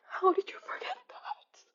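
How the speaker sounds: whispered, sighing disbelief; breathy whisper. Pretend. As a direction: sigh it out in a stunned whisper. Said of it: "And I said".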